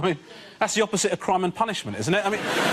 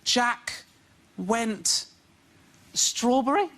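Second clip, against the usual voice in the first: put-on a child sounding out words, halting; slow, halting child reader; child reading aloud; 5 semitones higher, much slower than usual. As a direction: read it word by word like a struggling kid. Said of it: "when my son goes".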